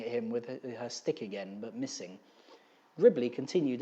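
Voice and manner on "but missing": ordinary delivery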